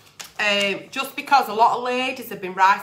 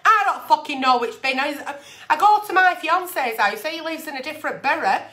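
ordinary delivery

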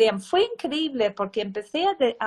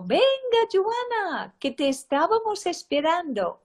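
teacher calling out; 7 semitones higher than usual. Retold or imitated. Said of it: "y gritó".

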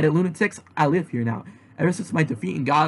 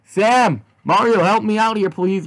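calling for help; 5 semitones higher, 4 dB louder than usual. Acted. Yazzie calls for help.

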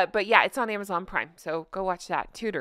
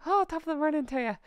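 put-on higher-pitched, playful Irish accent; 6 semitones higher, faster than usual. Playing Jamie answering her question, mock Irish accent. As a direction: put on a stage-Irish lilt. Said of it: "That's my Irish accent".

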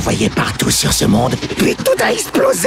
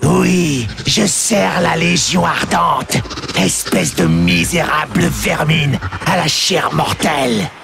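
demon shouting in rage, climbing higher; hissed curse. Acted. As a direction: snarl and build to a shout. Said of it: "Le démon feula une imprécation". (imprécation furieuse du démon, de plus en plus aiguë)

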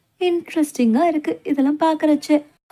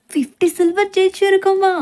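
higher, excited ஜெயிச்சிருக்கோமா rising; thrilled disbelief; 5 semitones higher than usual. Acted. Girl hears they won fifty silver, thrilled. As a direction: gasp out the winnings in wonder. Can't believe the winnings.

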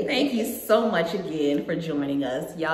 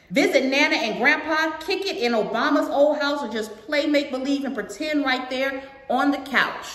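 put-on lilting read-aloud to a young audience; storybook narration with singsong rhythm; picture book read-aloud; 7 semitones higher than usual. A narrator's reading. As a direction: read it out brightly, line by line.

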